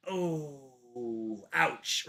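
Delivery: low, soft, deflated groan; sad. Acted. Rich young ruler told to give everything up, sad. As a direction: let it sink, quiet and wounded. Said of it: "like, sad".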